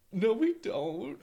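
high, slow Australian "No, we don't"; drawn out high Australian denial. Retold or imitated. Australians on TikTok denying their accent, high and slow. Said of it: "and they're like".

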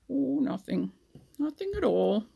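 ordinary delivery, nothing marked

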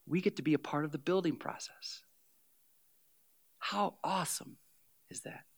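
almost whisper it; hushed awe. Real, not acted. Pastor marveling to the church, hushed.

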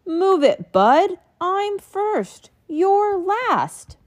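high, loud bossy taunting; pushy truck. Acted. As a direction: shove past like a bully truck. Grocery truck shouts.

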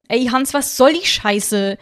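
upset. Acted. Emre confronting Hans in the museum, angry and loud. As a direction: bark it out in disbelief. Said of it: "sagt jemand aufgebracht".

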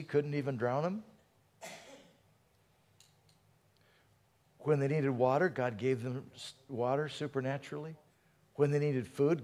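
soft, hushed reflection on God's care; quiet preaching. Acted. Preacher musing quietly to the congregation, hushed and intimate. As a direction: draw the church in close, softly.

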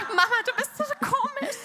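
high-pitched child imitation with amusement; imitated daughter. Daughter catches mother praying at night, high mock child voice. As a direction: raise it high like a kid teasing mum.

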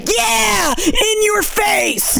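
very high, slow mock gloat; gloating mother. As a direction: crow over the beaten child. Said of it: "she's just like".

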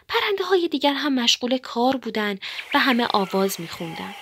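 ordinary delivery

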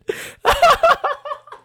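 loud high cackle; burst of laughter. Real, not acted. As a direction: crack up loudly at your own joke. Cracks up at own joke.

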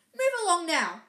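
loud, high, sharp command. Acted. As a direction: snap it out like a strict teacher. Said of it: "said a sharp voice".

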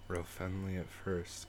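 quiet slow spooky hint; ominous low. Pretend.

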